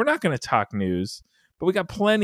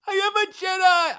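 very high, shouted Tracy Morgan yelp; Tracy Morgan impression. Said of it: "said like Tracy Morgan on Thirty Rock".

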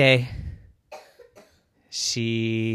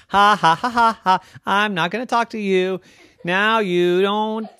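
high, teasing mock laugh into gloating; gleeful taunt; 8 semitones higher than usual. Yvette secretly refusing to answer everyone, gleeful taunt.

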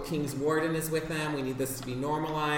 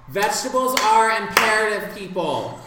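loud commanding chef voice; bossy head chef; 6 semitones higher, 7 dB louder than usual. Acted. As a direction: bark orders at the kitchen staff. Head chef ordering the kitchen.

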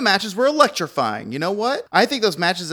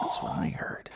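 whisper; 11 dB quieter than usual. Pretend. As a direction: draw the secret out in a whisper. Trading whispered rumors.

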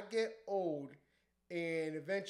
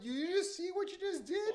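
retold disbelief, voice shooting up high; incredulous; 13 semitones higher, 4 dB louder than usual. DJ to drunk woman who tripped over his gear, incredulous. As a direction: squeak it out in mock disbelief.

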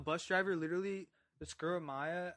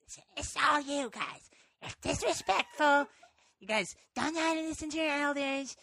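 scolding bus driver; 10 semitones higher, 8 dB louder than usual. Retold or imitated. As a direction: lecture the kids like a cranky driver. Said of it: "And she's like".

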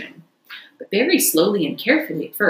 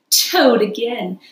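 gloating Toad; 3 semitones higher, 5 dB louder than usual. Acted. Toad gloating self-admiration.

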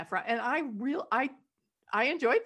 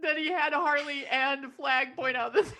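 normal talking voice

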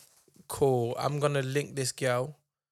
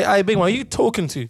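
louder, higher challenge thrown right back; mock-tough retort, louder and higher; squaring up back; 5 semitones higher, 6 dB louder than usual. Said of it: "Then I'll say".

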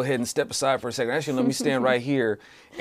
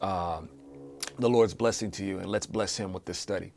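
soft, earnest prayer voice; 3 semitones lower, 4 dB quieter than usual. Acted. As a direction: lead the group in quiet prayer. Leads an opening prayer.

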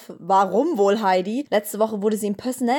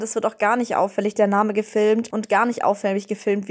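usual speaking voice

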